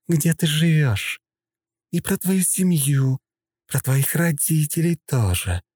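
high, slow and cold menace; menacing threat. A narrator's reading. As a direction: threaten his family slowly. Princess threatens the major.

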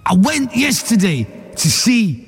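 slow, high Scouse comic delivery; John Bishop stage voice. Retold or imitated. Host mimicking John Bishop promoting his DVD, slow Scouse. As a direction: draw it out like a Scouse stand-up. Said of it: "So that's John Bishop that we all know".

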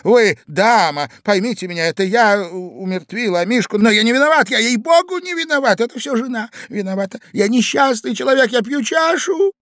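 whining plea climbing high; Иван Андреевич. A narrator's reading. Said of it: "обращаясь к молодой даме". (жалобная мольба)

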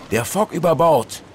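captain roaring over the storm, high and loud; a sea captain's alarm cry, full voice; thundering shout. Acted. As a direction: bellow it like thunder across the deck. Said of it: "schrie der Kapitän mit Donnerstimme".

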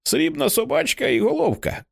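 put-on very high, sustained childlike voice; delighted child's cry. A narrator's reading. Child admiring the silver dog's head, delighted high cry. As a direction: hold it high like an awestruck child. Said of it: "кричить у захваті Хейді".

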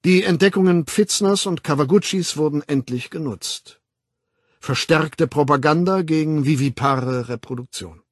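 emphatic public lecturer; lecturing World Controller. Acted. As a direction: hold forth with authority, punching key words. Weltaufsichtsrat doziert. (dozierend, nachdrücklich)

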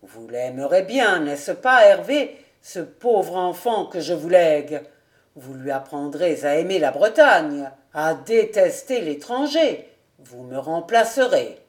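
earnest entreaty. A narrator's reading. Old nobleman entrusts a child to Hervé, earnestly. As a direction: urge him with mounting force.

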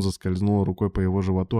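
usual speaking voice

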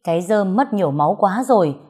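ordinary delivery, nothing marked

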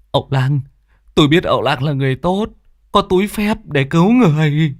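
rising high begging; a father pleading with the shaman; a hint of tearful pleading. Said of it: "chạy vào năn nỉ".

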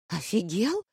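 affronted, without raising the volume; indignant. A narrator's reading. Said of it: "возмутилась Амаретта". (возмущённый вопрос высоким голосом)

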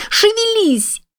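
very high, desperate yell; shouting. A narrator's reading. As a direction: cry it out even harder. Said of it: "кричала на него Кэт".

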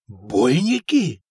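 slow astonished question; a stunned prince hearing of werewolves. A narrator's reading. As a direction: gape as you ask it. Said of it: "выпучил глаза владыка".

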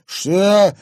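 loud, high indignant outburst; angered. Acted. Thug told to leave, angry. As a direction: burst out angrily. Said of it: "разозлился Рыжий".

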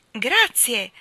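loud joyful shout of "Grazie!"; called thanks. A narrator's reading. The marble boy shouts his thanks after the gentleman, loud. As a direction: call it out after him, happy. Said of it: "gli gridò il ragazzo".

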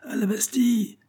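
very high-pitched rallying call; crowd's echoed cry. A narrator's reading. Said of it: "répéta la foule". (cri aigu repris par la foule)

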